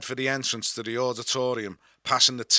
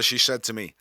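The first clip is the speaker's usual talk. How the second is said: ordinary delivery, nothing marked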